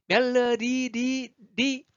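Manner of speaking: 멜로디 high with the final "ri" held up; exaggerated last syllable. Shown as a demonstration. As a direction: isolate 리 high after 멜로디. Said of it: "리는 또 아니에요".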